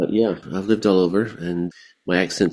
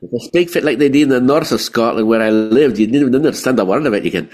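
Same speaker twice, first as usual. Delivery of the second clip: a hint of a northern Scottish accent, quick and a bit louder; 4 semitones higher, 5 dB louder, faster than usual. Shown as a demonstration. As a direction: slip briefly into a northern Scottish lilt, half laughing. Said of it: "could you go into a full-on Scottish accent?".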